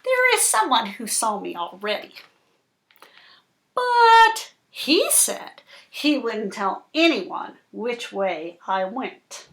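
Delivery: slow, dramatic picture-book reading; reading to children. Acted. Reading the fish story to Cooper, slow and dramatic. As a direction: draw the little listener into the story.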